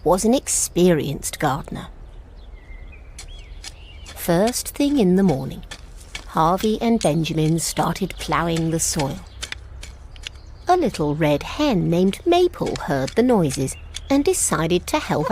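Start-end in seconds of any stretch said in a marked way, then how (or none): none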